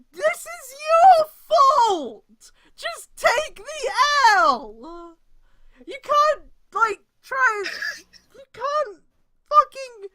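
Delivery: high, loud, exasperated yelling; anguished rant. Real, not acted. Streamer ranting at the fanfic author, exasperated yelling. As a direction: berate the author, voice cracking.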